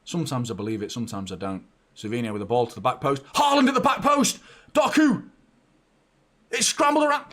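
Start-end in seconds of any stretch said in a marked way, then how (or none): 3.4-7.2 very high, loud goalmouth yell; urgent screamed play-by-play; excited commentary shout. Real, not acted. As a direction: shout the chance as it happens. Live chance at the back post.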